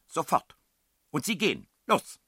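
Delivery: loud, high barked orders; angry commands. A narrator's reading. Headmistress sends child and strangers off, loud angry orders. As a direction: dismiss them with forceful short shouts.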